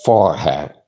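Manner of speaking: Forgan said the Dutch way with a throaty g; Dutch pronunciation. Shown as a demonstration. Showing a friend the Dutch way to say Forgan, throaty g. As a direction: Forgan with a guttural Dutch g, r rolled. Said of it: "I gotta say that in Dutch".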